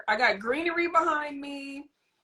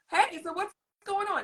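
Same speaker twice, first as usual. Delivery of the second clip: airy, higher casual greeting; breezy nonchalance; 9 semitones higher than usual. Shown as a demonstration. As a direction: act like nothing is happening. Casual chat while child tantrums.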